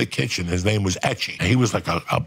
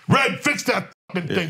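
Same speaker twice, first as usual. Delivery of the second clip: high, hammed-up prison yell at Red; mock inmate shout, pitched high; 10 semitones higher than usual. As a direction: bark it across the movie room. Inmates yelling at broken projector.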